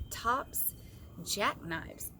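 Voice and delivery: ordinary delivery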